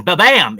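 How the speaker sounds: a punchy, loud ba-bam like a drum hit; ba-bam sound effect. Pretend. Streamer showing fans the big moment, loud ba-bam. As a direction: bang it out as a reveal sound. Mimicking a sudden dramatic reveal.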